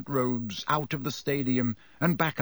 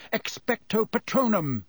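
higher-pitched roar of an incantation; roared spell; 7 semitones higher than usual. A narrator's reading. Harry casting at the Dementors, roared. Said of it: "whipped out his wand and roared".

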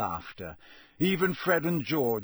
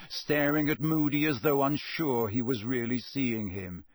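usual speaking voice

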